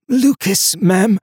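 high, strained hoarse voice; tense, high-pitched reply; shaken stranger. A narrator's reading. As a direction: answer as a frightened survivor. Shaken stranger gives his name.

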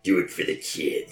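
sleazy pimp voice. Acted. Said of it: "my sleazy, gross pimp voice".

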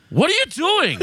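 high-pitched mock yell; Tony yelling. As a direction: squawk it in sudden outrage. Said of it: "Yells at the guest".

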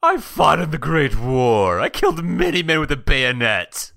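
boastful war veteran. Acted. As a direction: proclaim it like an old campaign tale. Character boasts of fighting skills.

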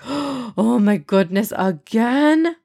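slow shocked disbelief rising on again; stunned, hardly believing it happened twice. Acted. Father shocked his daughter got poked again. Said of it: "Baba asked, shocked".

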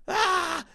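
sudden high-pitched yell of frustration; short, sharp cry of fed-up rage; raging scream. Retold or imitated. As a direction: let out a shriek of pure exasperation. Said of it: "I was like, at rage point".

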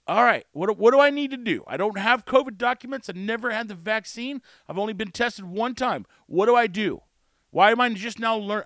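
really worked-up, loud frustrated demands; getting worked up. Real, not acted. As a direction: plead your case, getting genuinely heated. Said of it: "I'm getting worked up again".

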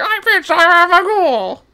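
high, slow creepy ghoul voice. Shown as a demonstration. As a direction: sing it out as a silly ghoul. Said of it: "it's supposed to be".

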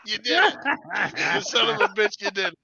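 high, loud laughing cheer; real laughing hype. Real, not acted. Laughing, hyped about dog.

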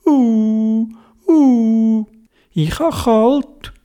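high, drawn-out wailing squirrel voice; pitiful little squirrel. Acted. Fallen squirrel tells Theobald it is cold, high pitiful moan. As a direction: moan each "uh" high and long, like a hurt small animal. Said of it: "Und das Eichhörnchen sagt".

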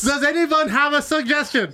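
high eager host voice. Pretend. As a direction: ask the crowd like a cheesy host. Improv host asks crowd.

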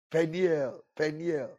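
ordinary delivery

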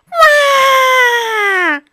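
high, loud cub bawl; crying wail. Acted. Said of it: "squealed Baby Bear".

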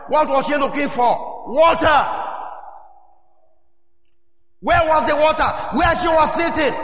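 fervent sermon peak; preaching shout. Real, not acted. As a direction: hammer the point to the crowd. Preacher rising to climax.

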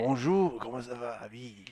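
exaggerated sing-song French; French accent. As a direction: ham it up as a cheery Frenchman. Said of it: "we can do it at French accent".

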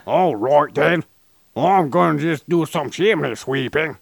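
a cheery old-timey fellow, hammed up; imitating Purple. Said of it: "No, he was going".

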